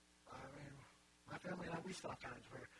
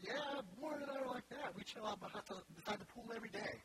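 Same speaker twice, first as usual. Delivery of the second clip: raised, breezy mock brag; mock boasting; 7 semitones higher, 3 dB louder than usual. Joking about hanging out with Warren Buffett, mock boastful. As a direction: toss out a pretend boast in a higher voice.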